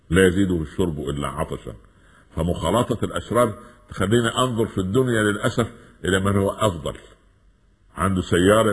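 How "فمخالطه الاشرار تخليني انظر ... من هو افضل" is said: ordinary talk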